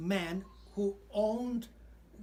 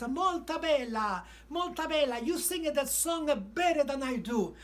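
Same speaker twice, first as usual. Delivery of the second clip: excited Italian praise; 6 semitones higher, 5 dB louder than usual. Retold or imitated. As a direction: gush like a thrilled Italian tenor. Famous tenor praising him excitedly.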